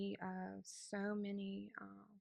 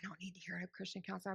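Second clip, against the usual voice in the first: 1 grumbling whisper; 6 dB quieter, much faster than usual. Retold or imitated.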